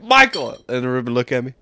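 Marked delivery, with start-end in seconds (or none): 0.0-0.5 higher, louder mock call of a name. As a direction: shout his name across the fair. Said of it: "somebody would be like".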